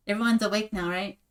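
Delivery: usual speaking voice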